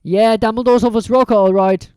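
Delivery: proud. Acted. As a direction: puff up with pride. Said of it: "said Ron proudly".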